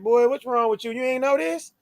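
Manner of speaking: playful mock reproach; incredulous scolding. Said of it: "thinking that tone".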